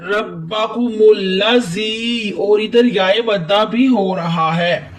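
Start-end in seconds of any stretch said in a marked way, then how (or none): 0.0-1.9 रब्बाकुमुल्लजी recited slowly, with bb and ll pressed; slow melodic reading of रब्बाकुमुल्लजी; Quran recitation. Shown as a demonstration. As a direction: chant रब्बाकुमुल्लजी in tajweed style. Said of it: "इस लफ्ज़ को ऐसे पढ़ेंगे".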